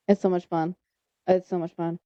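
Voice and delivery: normal talking voice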